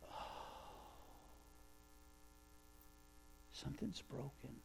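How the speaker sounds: faint, sad breath of words; Jesus grieving over brokenness; sorrowful murmur. Retold or imitated. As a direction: sigh it out softly. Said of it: "he's just going".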